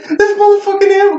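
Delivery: high-pitched mock panic; squealed, exasperated panic, played for laughs; retold freaking out. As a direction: freak out comically, voice shooting up. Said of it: "freaking out 'cause I'm like".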